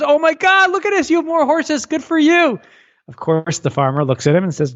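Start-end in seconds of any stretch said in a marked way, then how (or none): 0.0-2.6 very high, excited congratulations; thrilled neighbor. Acted. Neighbor rushes over to congratulate the farmer on new horses, high and excited. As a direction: play a gushing neighbor with the voice shooting up. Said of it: "comes running over again and goes".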